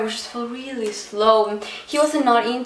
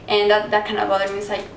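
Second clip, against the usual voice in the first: ordinary talk, nothing marked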